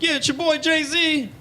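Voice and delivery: high, drawn-out rapper shout-out. Acted. Rapper introducing himself on his track, high slow mock announcement. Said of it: "the rappers always announce themselves".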